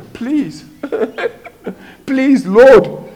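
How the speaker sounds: high, whining plea broken by a laugh; mock-pathetic begging in a high voice; whiny pleading Gideon. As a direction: snivel up to the Lord with a nervous laugh. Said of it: "he said to the angel".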